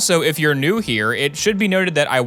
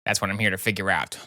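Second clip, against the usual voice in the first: low, soft, dry doctor; deadpan it like House; gruff doctor; 5 semitones lower, 6 dB quieter, faster than usual. Acted. House answering.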